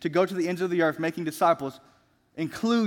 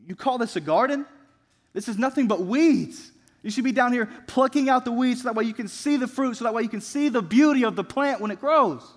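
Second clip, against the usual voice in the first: higher-pitched indignant scolding; incredulous critic; 6 semitones higher than usual. Acted. Visitor scolds the poet over his weedy garden, indignant. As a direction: act out an appalled visitor, voice lifted.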